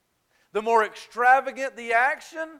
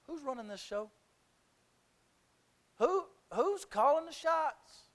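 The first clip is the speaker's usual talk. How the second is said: God's rebuke; 7 semitones higher, 7 dB quieter than usual. Retold or imitated. God questioning the preacher, soft then rising.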